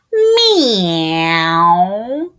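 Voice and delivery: drawn, crying meow; cat meow. Acted. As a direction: mew like a plaintive cat. Cat sound for children.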